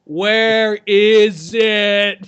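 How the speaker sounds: very slow stretched question; slow drawn-out. Acted. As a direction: drag every word out. Same question repeated, drawn out.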